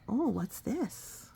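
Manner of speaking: usual speaking voice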